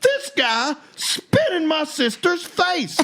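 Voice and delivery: high, drawn-out call for help; mock yell. Brother calling a policeman over about his sister, mock yell. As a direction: holler for the officer in mock outrage. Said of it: "yell at the police officer dealing traffic".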